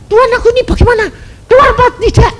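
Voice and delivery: high, rushed, loud cry to God; urgent mock pleading at high pitch; panicked prayer. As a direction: mimic a frantic demand for an answer.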